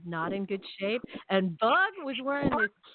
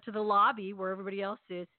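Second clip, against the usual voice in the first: ordinary talk, nothing marked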